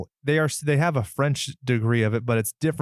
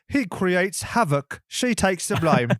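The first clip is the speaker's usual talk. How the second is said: put-on British tagline voice; British accent; 5 semitones higher than usual. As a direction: read it like a hammed-up Brit. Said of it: "this is clearly the British version".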